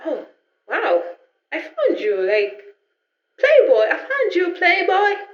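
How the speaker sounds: giddy, playful victory tease; teasing triumph. Retold or imitated. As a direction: taunt him like winning hide and seek. Playfully taunts her found father.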